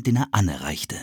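ordinary delivery, nothing marked

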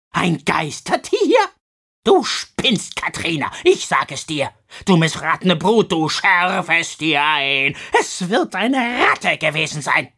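loud, spitting anger; furious scolding. Acted. Misses Crabone furiously scolding Katrina. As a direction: tear into her with hate. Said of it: "Sie spuckte beim Sprechen vor Wut und vor Hass".

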